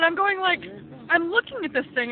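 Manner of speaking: normal talking voice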